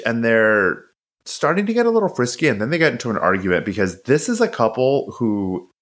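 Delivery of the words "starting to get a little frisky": playful. A narrator's reading. Describing couple flirting.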